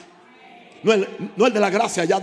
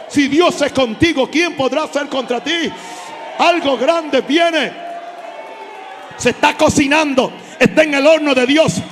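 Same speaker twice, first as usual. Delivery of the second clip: higher, louder shouted sermon with bursts of tongues; full-force preaching; 6 semitones higher, 6 dB louder than usual. Acted. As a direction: shout the prophecy over the crowd.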